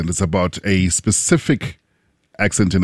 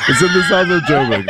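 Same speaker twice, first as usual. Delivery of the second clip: laughing shout; 5 semitones higher than usual. Real, not acted. Laughing shout repeating the joke.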